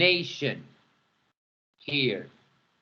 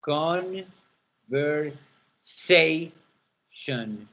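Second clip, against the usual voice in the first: "Conversation" drawn out very slowly, stress on sa; very slow stress drill; much slower than usual. Shown as a demonstration. Teacher stretches word slowly to show stressed syllable. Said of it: "Sation is the tonic syllable".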